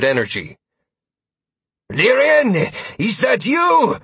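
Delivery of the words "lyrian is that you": high slow loud call. A narrator's reading. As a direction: call out unsure across fight. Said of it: "Sawyer's voice called out".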